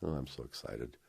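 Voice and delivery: ordinary talk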